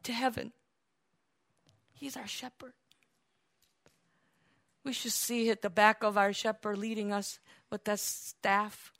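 low, soft, awed delivery; hushed reverent preaching. Real, not acted. Preacher telling the flock of following the shepherd, hushed reverence. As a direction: drop to an intimate hush with lingering pauses.

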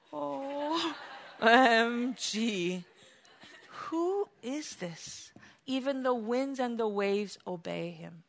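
stunned, slow and very soft; hushed awe. Acted. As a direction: breathe it in wonder, dropping to a hush. Acting out the amazed disciples.

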